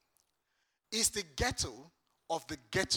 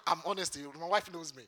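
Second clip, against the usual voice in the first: ordinary delivery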